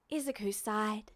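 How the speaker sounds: usual speaking voice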